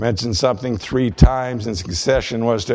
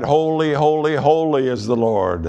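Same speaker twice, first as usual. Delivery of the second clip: raised pitch, mildly proclaimed; retold reverent cry; 4 semitones higher than usual. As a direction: imitate the seraphim's repeated cry, without shouting. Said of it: "They cried".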